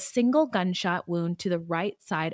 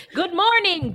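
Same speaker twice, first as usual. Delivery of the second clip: high singsong morning call; mock cheery greeting; 11 semitones higher, 4 dB louder than usual. Host acting out wife shooting husband in bed, mock cheery. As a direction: chirp it, then punch the bang.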